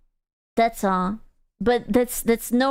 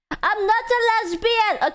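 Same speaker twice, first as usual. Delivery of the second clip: high protest; 8 semitones higher, slower than usual. Acted. Protesting a chat claim.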